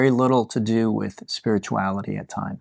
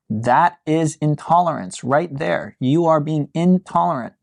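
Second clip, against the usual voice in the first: ordinary talk, nothing marked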